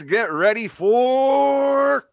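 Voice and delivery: big carnival barker hype; overbright kids party host. Pretend. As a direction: sell the intro like a game show. Host hypes podcast intro.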